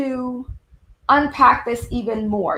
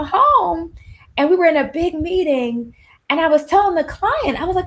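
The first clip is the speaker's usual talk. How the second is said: usual speaking voice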